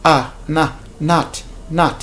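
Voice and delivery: not said as "naht", open ah; American short o. Shown as a demonstration. Teacher drilling the American vowel in steps, open ah. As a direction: build "not" up from ah to nah to naht. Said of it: "on a le son A".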